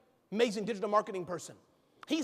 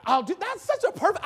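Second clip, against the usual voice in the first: ordinary talk, nothing marked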